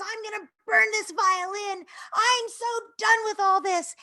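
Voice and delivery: hammed-up screaming tantrum, high and loud; imitated screaming. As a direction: yell it like a kid at breaking point. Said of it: "is going crazy screaming".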